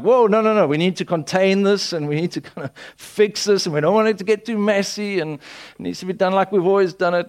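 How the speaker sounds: alarmed objection. Retold or imitated. People reacting as God begins to move, mock alarm. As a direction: imitate people hurriedly putting a stop to it. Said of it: "people are like".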